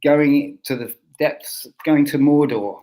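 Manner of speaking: ordinary talk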